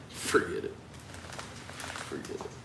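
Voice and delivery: hushed, trailing into near-silence; muttered to self. Real, not acted. Giving up on saying a thought, muttered to self. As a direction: murmur it very softly, almost whispering.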